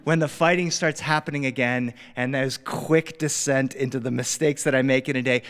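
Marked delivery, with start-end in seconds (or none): none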